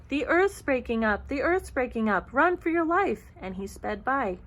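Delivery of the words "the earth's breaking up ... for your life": storybook rabbit shouting the alarm, louder; panicked shout. A narrator's reading. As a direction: shout a warning, climbing higher at the end. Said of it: "just shouted out".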